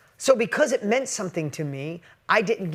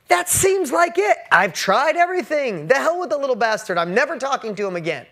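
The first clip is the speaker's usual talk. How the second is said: higher, louder, exasperated outburst; fed-up shout; 7 semitones higher, 7 dB louder than usual. Retold or imitated. Father giving up on his son, exasperated higher, louder shout. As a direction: blow up like a father who's tried everything.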